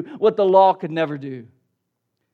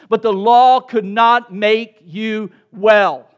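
loud, slow, word-by-word declaration; emphatic preaching; 9 dB louder, slower than usual. Real, not acted. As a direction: hammer each word home slowly. Preacher drives home the law's limit.

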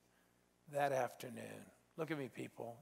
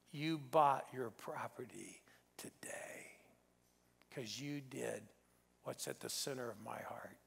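slow, softer whisper of a divine voice; hushed holy voice; 2 semitones lower, 6 dB quieter, slower than usual. Retold or imitated. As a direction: let the words fall soft and reverent. Quoting the voice from God.

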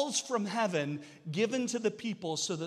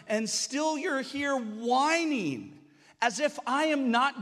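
exasperated scolding; 5 semitones higher than usual. Retold or imitated. God calling out the whining people, exasperated. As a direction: scold them like an exasperated father.

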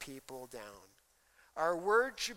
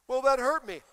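whiny excuse; 7 semitones higher, 9 dB louder than usual. Retold or imitated. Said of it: "husband's excuse".

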